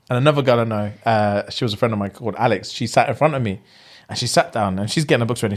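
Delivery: usual speaking voice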